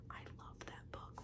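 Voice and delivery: whispered for emphasis; ASMR-style whispered love. Real, not acted. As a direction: whisper it to make it land. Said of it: "Why am I whispering? For emphasis".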